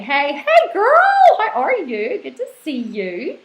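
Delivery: put-on high drawn-out hey girl; gushing greeting. Reading a viewer's comment as a high gushing greeting. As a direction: sing the greeting out bright and slow. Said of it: "Rasa is saying".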